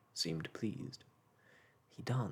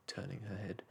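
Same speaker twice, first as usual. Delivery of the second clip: ordinary delivery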